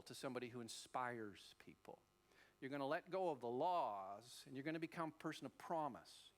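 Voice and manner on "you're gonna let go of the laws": usual speaking voice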